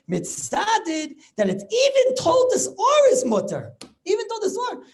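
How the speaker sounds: high, loud astonishment; astonished. Real, not acted. Rebbe stunned at Rabbi Akiva Eger's doubt, high and loud. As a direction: sound amazed at the ruling.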